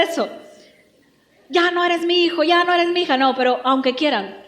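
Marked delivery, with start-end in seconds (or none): 1.5-3.2 slightly raised, indignant parent voice; mock disowning, pitched up; parent disowning a child. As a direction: lightly act a parent renouncing their kid.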